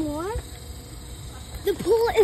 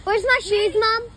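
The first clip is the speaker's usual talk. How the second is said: yelling across the room; 5 semitones higher than usual. Real, not acted. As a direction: shout it so Mom hears downstairs. Calling out to Mom.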